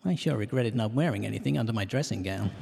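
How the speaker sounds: normal talking voice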